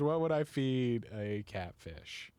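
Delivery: ordinary talk, nothing marked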